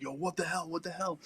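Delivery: high, softened imitation of an alarmed sergeant; retold alarm. Sergeant reacting to sudden gunfire, retold alarm. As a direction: mimic startled disbelief without volume.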